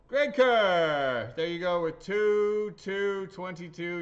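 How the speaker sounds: high, drawn-out shout; big slow announcer call; loud winner announcement. Real, not acted. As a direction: holler the winner's name like a raffle host. Calling out the winner.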